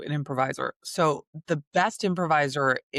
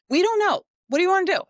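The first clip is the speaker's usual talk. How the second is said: much higher and louder mock doctor; imitating the doctor; 6 dB louder, much faster than usual. Retelling what the doctor said before the birth, higher and louder mock doctor. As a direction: play the doctor shrugging off the decision, higher and louder.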